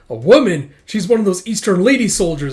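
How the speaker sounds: loud, high startled shout; surprised alarm. Acted. Enemy soldier spots her.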